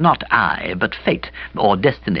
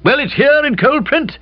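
put-on higher, brisk older lady; brisk Aunt Dahlia. A narrator's reading. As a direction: lay out the evidence briskly.

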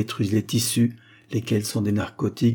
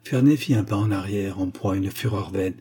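ordinary delivery

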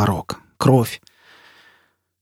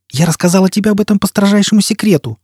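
higher, angry outburst; flaring anger; 9 semitones higher, 4 dB louder than usual. Acted. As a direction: flare up at him in anger. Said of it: "гневно вспыхнула Джизбелла". (вспыхнула гневно, на высокой ноте)

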